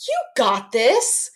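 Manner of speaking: slow, rising and upbeat; encouraging inflection. Shown as a demonstration. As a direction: cheer your neighbor on. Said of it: "in that type of inflection".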